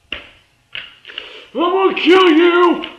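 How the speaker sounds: a high, slow yell of fury; furious shout. Acted. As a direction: roar it at the screen, slow and very loud. Frustrated viewer yelling at TV.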